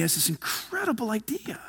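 whispered, leaning in with a secret; hushed whisper. A narrator's reading. To the congregation, telling the bicycle story: a secretive whisper. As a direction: breathe it out like a conspiracy.

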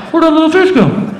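high, loud, muffled snorkel voice; hooted question through a snorkel; talking through snorkel. Acted. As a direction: ask it as if through a tube. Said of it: "I'm talking to her through the snorkel".

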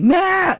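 high-pitched "meh" imitating bickering; mock argumentative squawk. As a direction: squawk it out like a petty back-and-forth. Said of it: "like, argumentativeness".

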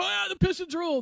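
high-pitched mock celebratory yell; imitated cheering fan. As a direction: whoop it up like a fan briefly let on the air. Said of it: "they'd come in and go".

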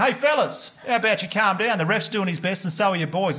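high-pitched telling-off; indignant calling-out. Retold or imitated. Sugar-fuelled parent confronting two fathers at kids' soccer, indignant. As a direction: call across to rowdy men, fired up.

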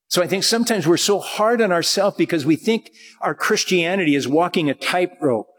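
normal talking voice

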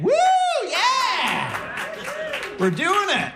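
high, whooping celebration; hammed-up excited woo; mock cheer. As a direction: cheer like you just won, voice jumping up. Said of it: "You think".

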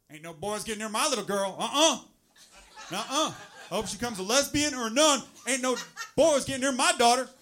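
loud Southern overprotective dad. Acted. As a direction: play a blustering Southern father guarding his girl. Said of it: "I don't know why I make him Southern".

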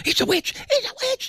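high-pitched accusing cry, hammed up; witch-hunt accuser. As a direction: squeal it like a panicked villager pointing out a witch. Mimicking hysterical Salem accusers.

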